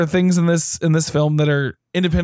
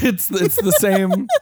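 ordinary talk

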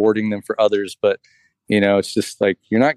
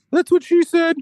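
high, silly that's-what-she-said delivery; mock joke voice. Husband delivering the punchline in a goofy higher voice.

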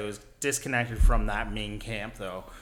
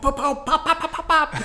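high laughing pops; mock gunfire, laughing; 6 dB louder than usual. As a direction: pop it out like rifle fire. Imitating gunfire.

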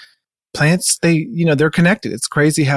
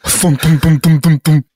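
knocking noise; 3 dB louder than usual. Acted. Door knocker sound imitated.

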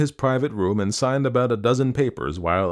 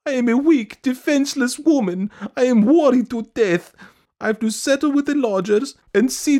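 very high, loud lament; hammed-up, self-pitying high wail; 7 dB louder than usual. A narrator's reading. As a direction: wail out your woes in a piercing voice. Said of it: "wailed Madame Stuchkin".

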